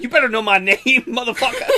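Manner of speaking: playfully angry; mock anger. Streamer mock-threatening a fan over names, loud. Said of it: "that's my anger issue problem".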